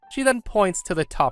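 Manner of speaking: ordinary delivery, nothing marked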